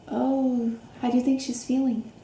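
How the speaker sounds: plain reading voice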